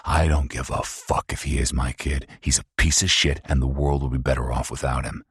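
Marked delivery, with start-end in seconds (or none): none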